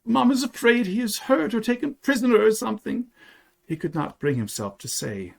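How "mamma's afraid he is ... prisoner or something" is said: put-on high child voice, a touch upset; a small boy's high, mildly choked worry; choking a bit. A narrator's reading. Boy fears for his soldier papa, choking a bit. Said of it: "choking a bit".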